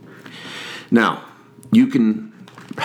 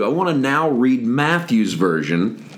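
usual speaking voice

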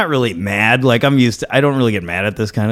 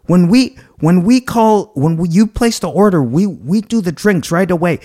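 high, loud lecturing employee; mock scolding; 9 semitones higher, 3 dB louder than usual. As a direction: imitate her telling him off, pitched up. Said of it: "she scolds me some more".

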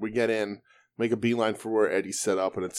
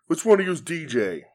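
raised, higher-pitched demand; mock-challenge the whole line, pitched up; imitated calling out; 7 semitones higher than usual. Wrestler asking the queue who DJ is, imitated calling out.

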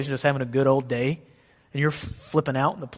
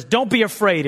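higher, louder bare order; curt command; 5 semitones higher, 4 dB louder than usual. Shown as a demonstration. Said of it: "God just never says".

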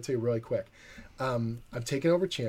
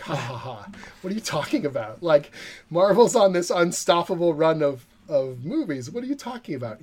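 louder, rising disbelief; incredulous scoff; 5 semitones higher, 6 dB louder than usual. Retold or imitated. Writer scoffing at his friend's claim, incredulous. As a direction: laugh it off as absurd. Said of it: "And I was like".

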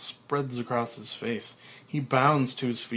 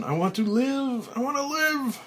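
high, loud pleading shout rising higher; desperate cry; 13 semitones higher, 3 dB louder than usual. Acted. Frank pounding the coffin, desperate shout. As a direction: beg for your life at full voice. Buried alive, pounding the lid.

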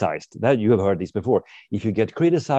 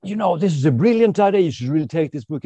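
excited pitch; 8 semitones higher, 4 dB louder than usual. Retold or imitated. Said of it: "and said".

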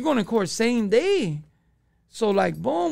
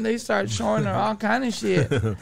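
normal talking voice

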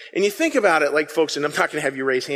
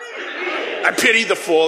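slow, laughing tough-guy shout; Mister T with attitude; 4 semitones higher, slower than usual. Shown as a demonstration. Preacher doing Mister T for the congregation, slow laughing shout. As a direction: lean in and sell the catchphrase with swagger. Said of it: "could we say it with some attitude?".

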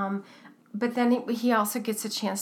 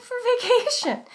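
mock kid answer; 5 dB louder than usual. Mocking kid's answer to Gordon.

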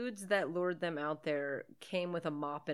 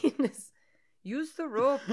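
loud, higher fake Irish; Irish accent attempt; 7 semitones higher, 7 dB louder than usual. Friend riffing on the film, hammed-up Irish accent. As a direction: push the mock Irish lilt hard. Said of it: "tried to talk in an Irish accent".

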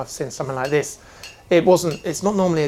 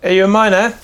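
louder suspicious dwarf question; gruff dwarf voice; 5 semitones higher, 9 dB louder than usual. Acted. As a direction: eye them up and ask. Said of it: "He looks over and goes".

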